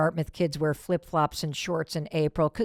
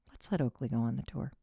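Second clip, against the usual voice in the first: gentle reminder; 6 semitones lower, 7 dB quieter, faster than usual. Retold or imitated. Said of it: "And he's like".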